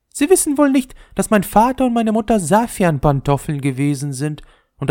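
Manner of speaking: very high, dainty ball voice; the leather ball snubbing the top, very high; haughty little ball. Acted. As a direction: look down your nose at the top. Ball boasting of parents.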